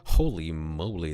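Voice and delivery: plain reading voice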